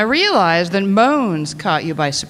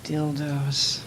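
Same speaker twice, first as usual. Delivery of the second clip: low, very soft murmur; hushed savouring; 8 semitones lower, 12 dB quieter than usual. Acted. Dominatrix lingering over her props, hushed savouring. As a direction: savour it under your breath, deep and hushed. Savours the word after gasp.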